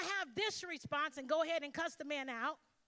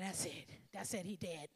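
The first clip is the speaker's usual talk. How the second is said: quiet fury; 8 semitones lower, 8 dB quieter than usual. Retold or imitated. Seething threat to dad.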